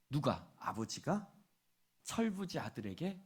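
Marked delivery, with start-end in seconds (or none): none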